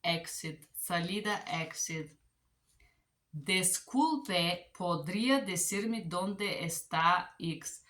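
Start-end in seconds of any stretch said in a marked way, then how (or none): 3.4-7.8 slow, careful Spanish question; careful learner's Spanish; Spanish phrase. Shown as a demonstration. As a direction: read the Spanish line slowly. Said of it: "let's try this".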